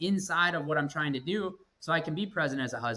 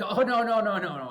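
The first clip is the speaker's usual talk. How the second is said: higher, louder run of protesting no's; retold emphatic denial; 7 semitones higher, 5 dB louder than usual. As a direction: hurry to set a child straight, pitched up. Hasty denial retold to his daughter.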